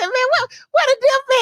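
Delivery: high-pitched mock complaint; high mock question. Quoting herself asking for dip.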